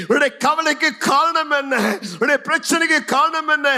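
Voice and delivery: rising to high, shouted proclamation; full-force preaching. Real, not acted. Preacher telling congregation tears are over, impassioned.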